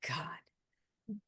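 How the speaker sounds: whispered aside. Real, not acted. Muttered aside mid-sentence.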